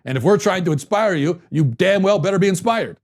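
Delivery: lofty, entitled, demanding they be inspired; smug celebrity attitude. Retold or imitated. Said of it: "That's the attitude that I don't like".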